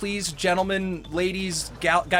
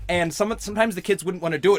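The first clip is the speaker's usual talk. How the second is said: normal talking voice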